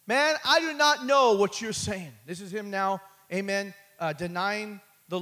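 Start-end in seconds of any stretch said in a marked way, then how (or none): 0.1-2.1 loud, high, slow protest; Peter's denial. Retold or imitated. Preacher voicing Peter denying Jesus, loud and high. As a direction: shout the denial out.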